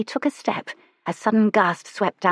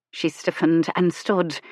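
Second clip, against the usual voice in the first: plain reading voice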